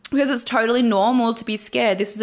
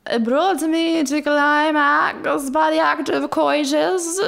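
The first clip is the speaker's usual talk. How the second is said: mock sexy formal; 6 semitones higher than usual. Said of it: "I'm trying to make it sound sexy".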